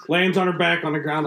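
ordinary delivery

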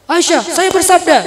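high, loud mock pronouncement; pompous proclamation. Husband pronouncing to his wife, mock-authoritative. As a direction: declare it with exaggerated authority.

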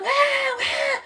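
put-on high-pitched baby crying "ué, ué"; imitated toddler wail. Imitando o Elinho chorando, choro de bebê agudo. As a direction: wail like a crying three-year-old. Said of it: "o Elinho chorando".

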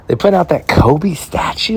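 pure fan excitement; hyped. Real, not acted. Hyped about Kobe statue.

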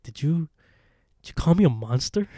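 retold affronted question, rising at the end; offended disbelief. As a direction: act out stung disbelief.

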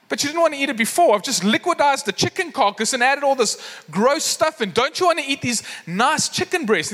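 high-pitched, exasperated chef; getting upset. Retold or imitated. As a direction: imitate a chef pleading with kids in disbelief. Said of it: "just getting so upset".

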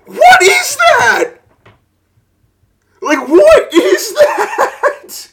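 very high, slow, yelled disbelief; incredulous shout. Real, not acted. Yelling at the absurd paperwork in disbelief. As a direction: shriek it out, half laughing. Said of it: "I'm pissed".

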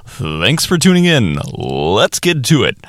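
slow, deliberate broadcaster read through a laugh; hammed-up radio host moving things along; mock announcer voice; radio sign-on. Shown as a demonstration. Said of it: "as a radio broadcast edit".

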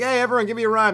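high, loud call to the crowd; rally everyone around you eagerly; eager freestyler. Retold or imitated. Freestyler asking everyone for a rhyme, eager.

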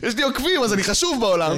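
high, pompous mock boast; arrogant influencer. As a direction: puff yourself up like a star. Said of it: "mocking arrogant influencer".

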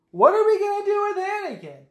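very high, loud fretting; exaggerated squeal of worry; mock alarm. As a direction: play flustered Jedi in a panic. Voicing worried Jedi.

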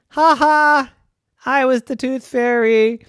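high, loud, teasing ha ha; the littlest child revealing the prank; gleeful little kid. Acted.

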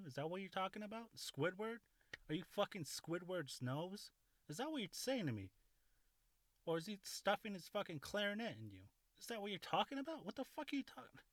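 high-pitched, incredulous mock interrogation; mock outrage. Storyteller grilling listeners about Squidward and tentacles, mock outrage.

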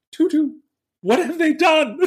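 high, laughing mock Countess wail; dismayed Countess. Countess asks Tutu about the wrecked room, high mock dismay. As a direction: ham up the Countess's horror at the mess.

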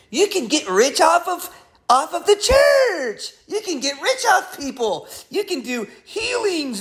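high mocking sales pitch; mocking greedy preacher. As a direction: parody a money-hungry pastor. Mocking greedy pastors' message.

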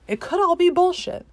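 high, loud burst on all; real frustrated yell; sudden outburst. Real, not acted. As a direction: blurt it out, exasperated. Ranting about fake evidence.

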